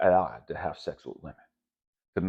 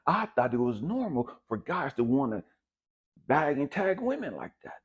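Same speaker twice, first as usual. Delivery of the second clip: heated emphasis; 7 semitones higher, 4 dB louder than usual. Shown as a demonstration. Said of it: "repeated line, second pushed".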